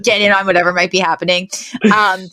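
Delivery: normal talking voice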